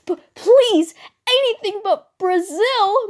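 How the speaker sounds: high, loud, drawn-out plea; panicked begging. Acted. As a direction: beg as if your life depends on it. Catboy begging not to go.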